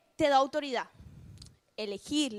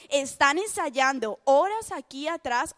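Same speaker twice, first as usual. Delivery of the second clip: ordinary talk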